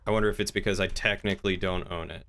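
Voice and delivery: normal talking voice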